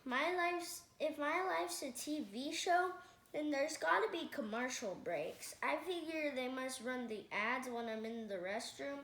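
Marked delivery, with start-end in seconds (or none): none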